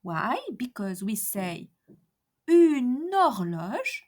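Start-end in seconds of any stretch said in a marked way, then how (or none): 2.5-4.0 "une horloge" with the N running straight into horloge, no break; linked une horloge. Shown as a demonstration. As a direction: join "une horloge" smoothly. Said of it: "and not une horloge".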